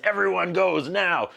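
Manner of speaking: mock-imperious, drawn-out command; commanding leader. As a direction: put on a herd boss giving orders. Stern leader orders the herd.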